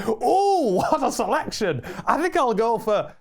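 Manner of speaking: high, loud mock amazement; hammed-up delight, drawing out the last words; very enthusiastic. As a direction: marvel like an absurdly overkeen customer. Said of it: "he's very enthusiastic".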